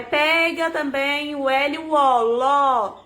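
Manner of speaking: slow, drawn-out syllables for a learner; measured, deliberate. Shown as a demonstration. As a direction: guide a child slowly, stretching each letter. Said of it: "falando pra ela compassadamente".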